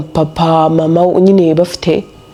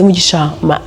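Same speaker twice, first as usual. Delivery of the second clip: usual speaking voice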